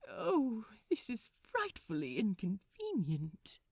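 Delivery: a fussy, put-upon sigh of a complaint; lightly voiced prim gentleman, mildly dismayed; lamenting Aziraphale. Trapped in the dark vestibule, Aziraphale mildly laments. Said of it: "he lamented".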